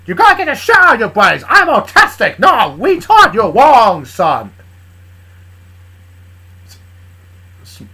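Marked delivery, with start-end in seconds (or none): 0.1-4.5 loud, blustering Southern cartoon rooster; Southern cartoon chicken. Charlie rebuking Big as an indignant Southern cartoon chicken. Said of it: "speaking like a chicken in a Southern accent".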